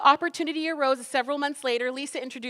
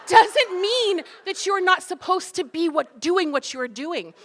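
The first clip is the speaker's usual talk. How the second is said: usual speaking voice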